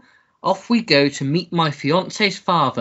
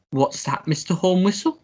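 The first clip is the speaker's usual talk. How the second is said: ordinary talk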